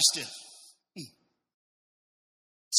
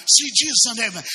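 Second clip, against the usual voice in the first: mild mock yell, high and louder; retold yell; 5 semitones higher, 7 dB louder, faster than usual. Preacher acting the blind man calling for pity, mild yell. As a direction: call out like the blind man, half full. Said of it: "So he's yelling".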